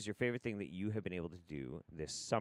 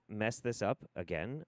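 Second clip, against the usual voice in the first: ordinary delivery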